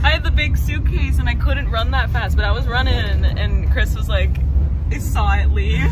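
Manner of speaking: loud voice over train noise; shouting on a subway. Real, not acted.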